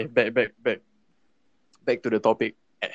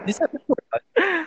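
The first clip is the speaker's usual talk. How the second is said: pitched-up amazed outburst; high surprised exclaim. Real, not acted. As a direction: squeal it in disbelief. Disbelief at the story.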